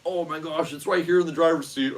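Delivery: lightly voiced relieved friend; friend impression. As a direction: imitate her relieved discovery. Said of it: "that's not what she sounds like".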